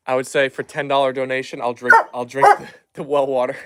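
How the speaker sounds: ordinary delivery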